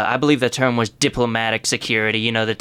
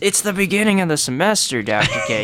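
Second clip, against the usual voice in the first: mock exasperation; 6 semitones higher than usual. Guest mock-scolds the strict teacher.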